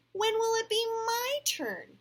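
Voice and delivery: loud, high petulant whine; pouting. A narrator's reading. Jenny demands her turn at show and tell, pouting. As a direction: wail it with a pout. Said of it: "Jenny pouted".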